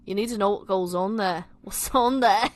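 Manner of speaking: clumsy Scouse breaking into laughter; bad Liverpudlian accent. Tom urging Nick, bad Liverpudlian accent. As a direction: attempt a Liverpool accent and crack up. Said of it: "my shit Liverpudlian accent".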